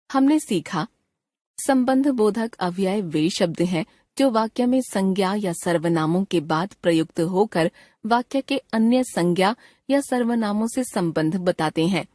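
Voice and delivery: ordinary delivery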